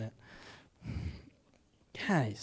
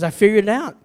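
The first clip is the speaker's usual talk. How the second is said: loud, higher-pitched daughter's discovery; retold triumphant announcement; 6 semitones higher, 12 dB louder, faster than usual. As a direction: imitate a girl proudly announcing it, loudly. Daughter announces her big discovery.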